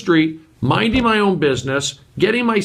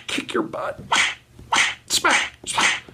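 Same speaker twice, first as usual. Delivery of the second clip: whispered; 5 dB quieter, slower than usual. Pretend. Mock fight, whispered.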